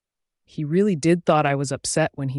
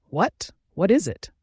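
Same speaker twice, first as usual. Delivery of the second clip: higher-pitched, mildly frantic questioning; 5 semitones higher than usual. A narrator's reading. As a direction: ask with a touch of alarm. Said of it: "he asked frantically".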